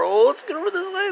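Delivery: high cooing to the cat. Real, not acted. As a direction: coo at the cat. Baby talk to cat.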